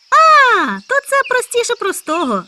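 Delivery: high loud proud answer; proudly. Acted. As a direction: boast that it's easy. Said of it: "з гордістю відповів заєць". (з гордістю, високо й гучно)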